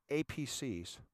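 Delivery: ordinary talk, nothing marked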